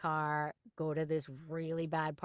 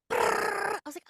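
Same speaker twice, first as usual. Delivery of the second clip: squeaky high panic, as her racing heart; panicked heart voice; 9 dB louder, slower than usual. Retold or imitated. Heart squeals in panic at Russian lines. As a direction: let your heart squeal it in alarm. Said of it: "my heart was like".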